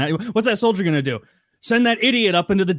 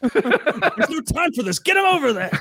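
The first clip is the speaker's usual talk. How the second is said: high, urgent mock command; hammed-up order to move him along; hurried officer; 11 semitones higher than usual. Officer rushing the unwilling recruit, high and urgent.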